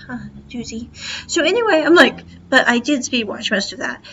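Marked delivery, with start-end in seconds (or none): 0.1-0.9 whispered aside. Retold or imitated. Muttered aside about the episode.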